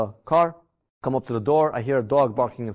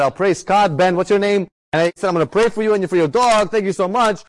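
retold gushing, higher and louder; effusive thanks; 5 semitones higher, 4 dB louder than usual. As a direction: pour out gratitude, pitched up. Retold profuse thanks to helper.

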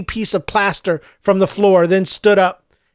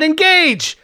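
high, emphatic shout; villain's insistent outburst; shouted command; 7 semitones higher than usual. A narrator's reading. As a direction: shout the final order, pitched way up. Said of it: "he shouted".

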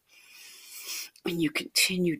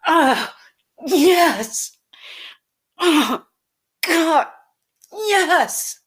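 very high, loud ecstatic cries; screamed in pleasure; 11 semitones higher, 10 dB louder, much slower than usual. Acted. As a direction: cry out at full pitch in pleasure. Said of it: "I just screamed in pleasure".

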